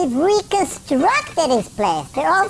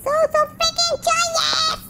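very high, squealed delight; hammed-up joy; slower than usual. Player gushing over an easy treat run, high mock joy. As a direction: let the voice soar with mock glee.

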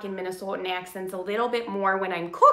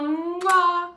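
blowing a kiss; 7 semitones higher than usual. Real, not acted. Signing off the livestream.

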